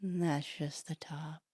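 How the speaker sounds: slow, drawn-out stoner musing. Acted. Stoner musing to a stoned friend, slow and spaced-out. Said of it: "someone who was also stoned".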